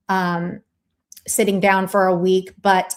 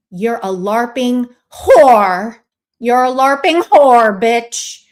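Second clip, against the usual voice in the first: loud, slow insult, voice raised; angry yell; 5 dB louder, slower than usual. Real, not acted. Host yells at the absent streamer, loud and angry. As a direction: yell it at him with contempt.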